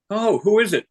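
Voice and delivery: a hint of a puzzled homeowner; lightly voiced homeowner. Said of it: "owner of the house comes home and he says".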